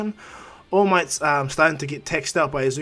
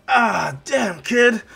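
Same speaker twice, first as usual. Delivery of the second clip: loud, high cry of pain; pained exclamation; 8 semitones higher, 7 dB louder, much slower than usual. Acted. All Might punched by the kid, pained loud exclamation. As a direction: cry out at a heavy hit.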